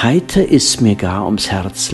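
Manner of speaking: ordinary delivery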